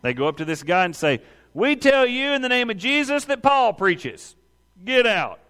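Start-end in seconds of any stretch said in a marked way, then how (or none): 1.5-5.4 high-pitched mock exorcist decree; hammed-up commanding exorcist; exorcist's command. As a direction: proclaim it higher, as if casting out a demon. Acting exorcists commanding a demon.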